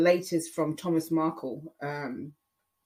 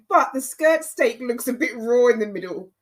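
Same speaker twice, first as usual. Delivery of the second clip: mum's doubt; 6 semitones higher, 6 dB louder than usual. Retold or imitated. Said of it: "She goes, she goes".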